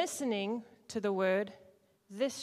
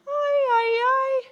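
much higher, loud pirate squawk; high loud pirate aye; 11 dB louder, much slower than usual. Shown as a demonstration. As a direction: cry it out like a pirate crew. Pirate voice in demo.

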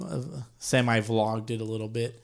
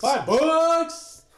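much higher, louder squeaky echo; silly high echo; 5 dB louder than usual. Pretend. Co-host echoing the umpire fee bit, squeaky and high. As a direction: parrot it in a goofy squeak.

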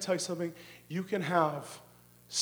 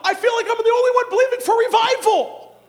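high, full-force shouted complaint; frustrated shout; 15 dB louder than usual. Acted. Lone believer complains to God about revival, frustrated shout. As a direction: cry it out to God at full volume. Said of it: "Sometimes you feel like".

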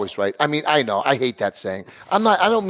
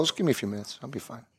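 softer, deflated mock sulk; 8 semitones lower, 12 dB quieter, faster than usual. Cooling off after the rant, softer mock sulk. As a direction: pout it out quietly.

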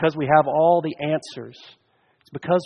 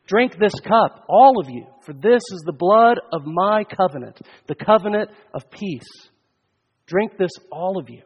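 raised, ringing preacher's invitation; solemn proclamation; 4 semitones higher, 5 dB louder than usual. Real, not acted. Preacher gives Christ's words to all at the feast, raised proclamation. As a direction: proclaim each phrase high and emphatic.

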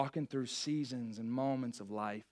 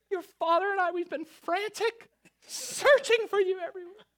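frantic mother; 3 dB louder than usual. Retold or imitated. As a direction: plead as a scared mom who lost her son. Mother scolding lost son.

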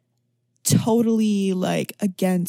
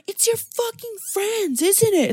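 mock accusing yell; 12 semitones higher than usual. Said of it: "He was like".

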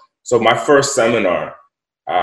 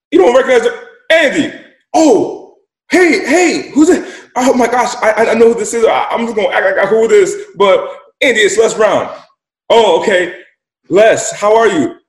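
high, loud, thrilled greeting; light up hearing a hero on the line; surprised by a call from a famous speaker, high and loud; excited phone call; 12 semitones higher, 6 dB louder than usual. Retold or imitated. Phone call from Les Brown.

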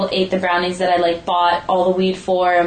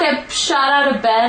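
normal talking voice

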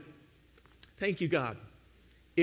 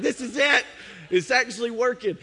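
high and loud, laughing with joy; thrilled laugh; 7 semitones higher, 5 dB louder than usual. Retold or imitated. Husband laughing with joy as the lantern lifts off. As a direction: crow it out like it finally worked.

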